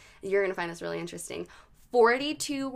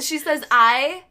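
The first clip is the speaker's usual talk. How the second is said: usual speaking voice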